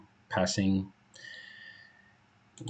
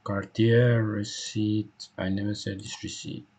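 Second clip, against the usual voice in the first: normal talking voice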